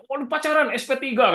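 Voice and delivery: high, scolding exclamation; angry teacher outburst. Retold or imitated. Ustaz scolding a student caught dating, high angry outburst. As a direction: snap at the student in shock and anger. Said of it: "kadang dimarahin langsung dihukum".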